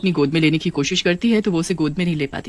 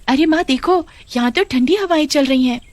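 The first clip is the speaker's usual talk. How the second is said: put-on high, happy little girl voice; a child thrilled to be out with mum; cheerful young daughter; 7 semitones higher than usual. Daughter tells her mother she loves the walk, high and happy.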